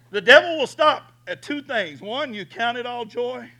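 high, loud preaching cry; full-force preaching. Real, not acted. Preacher driving his point home to the congregation, full force. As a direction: preach it hard to the room.

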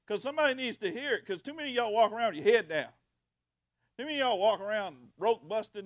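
loud, fast preaching build; fiery preaching. Acted. Pastor rousing the congregation, loud and fast. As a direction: drive it home to the congregation.

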